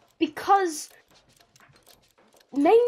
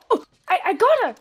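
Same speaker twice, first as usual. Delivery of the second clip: excited; 4 semitones higher, 5 dB louder, faster than usual. Retold or imitated. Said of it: "Momo's eyes, like, light up".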